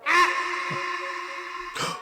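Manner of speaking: high, drawn-out gasp; acted shocked gasp; horrified gasp. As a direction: suck in air as if horrified. Class reacts to gum in hair.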